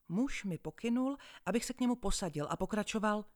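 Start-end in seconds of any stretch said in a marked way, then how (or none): none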